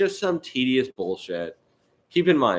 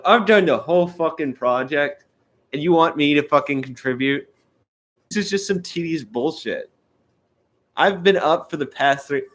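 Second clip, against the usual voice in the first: raised, heated rant; ranting indignant inner voice; 6 semitones higher, 4 dB louder than usual. Retold or imitated. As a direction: vent it like you're fed up. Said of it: "I'm thinking to myself".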